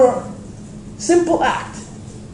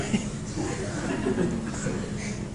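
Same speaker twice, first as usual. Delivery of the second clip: breathy laugh; 13 dB quieter than usual. Real, not acted. Laughs at own accent.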